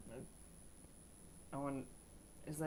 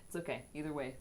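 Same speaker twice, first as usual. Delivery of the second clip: normal talking voice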